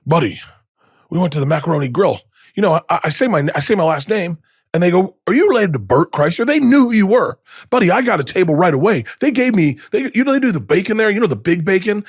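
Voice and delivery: imitating his dad, high and excited; bubbling over with proud-dad glee; gushing dad. Dad brags about getting a table, excitedly.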